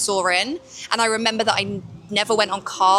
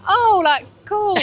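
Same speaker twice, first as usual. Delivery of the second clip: mildly impressed reaction; 9 semitones higher, slower than usual. Retold or imitated. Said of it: "I think I'm like".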